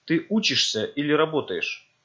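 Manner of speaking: ordinary delivery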